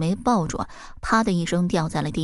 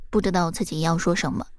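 ordinary delivery

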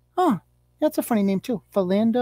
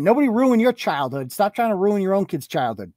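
ordinary delivery

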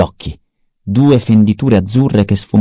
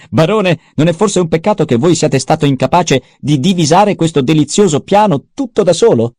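Peter the mentat; 8 semitones higher than usual. A narrator's reading. Mentat Peter reporting to the baron, high and sneering. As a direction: needle the baron in a high mocking tone. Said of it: "Peter taunting the baron".

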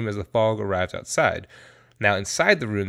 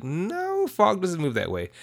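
high squeaky no, then dismissive; mock dismissive; 8 semitones higher, slower than usual. Weatherman waving off the DJ, mock dismissive. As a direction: brush her off in a silly high voice.

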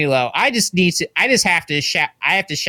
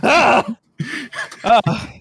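disgusted groan; 4 semitones higher, much slower than usual. Real, not acted. Disgust at named countries.

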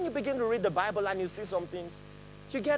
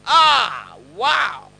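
delighted exclaim; 14 dB louder than usual. Pretend. Someone delighting over you.

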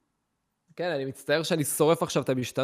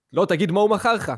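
higher, pushy mock cop questions; cop interrogation; 4 semitones higher than usual. Police officers grilling the narrator, higher and pushy. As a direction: fire the questions like a suspicious cop.

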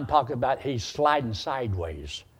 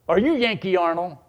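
higher, louder mock-threatening caller; mean and ugly; 5 semitones higher, 4 dB louder than usual. Irate father phoning the preacher at night, mean and ugly. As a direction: demand it like a hostile caller. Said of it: "Real mean and ugly".

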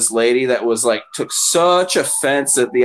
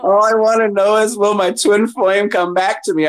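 higher, dreamy client plea, pitched up; mock lovesick client; 8 semitones higher, 3 dB louder than usual. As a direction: ham up the needy twin flame question.

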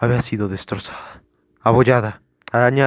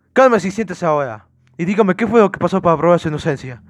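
high, loud interrogator's demand; firm order; 6 semitones higher, 4 dB louder than usual. Acted. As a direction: press the suspect with raised voice. Officer demands calm and answers.

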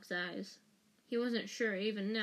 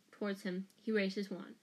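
ordinary delivery, nothing marked

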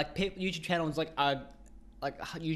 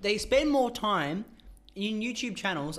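slow emphatic rant; 6 semitones higher, 3 dB louder, slower than usual. Real, not acted.

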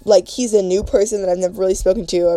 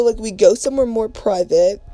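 ordinary delivery

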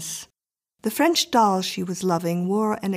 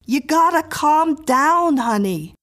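soothing neighbour; 8 semitones higher, 6 dB louder than usual. A narrator's reading. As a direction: tell her firmly to settle, stretching the words. Woman calms Mrs Miller.

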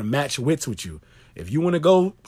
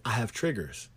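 normal talking voice

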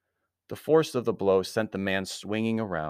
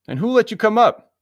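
high, loud challenge; indignant demand; 13 dB louder than usual. A narrator's reading. Anthony challenging the intruder in his room, loud and indignant. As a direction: pitch it right up and demand an answer.

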